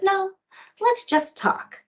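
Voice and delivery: put-on be a four-year-old wanting to chat; little girl. Birthday girl answering the adult, in a slow little-girl voice.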